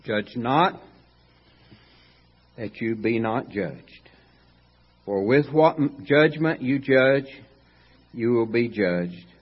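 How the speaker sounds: slow, measured reading of scripture. Acted. Preacher reading Matthew aloud to congregation, slow and solemn. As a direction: read the verses slowly and solemnly.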